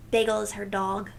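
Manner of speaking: ordinary delivery, nothing marked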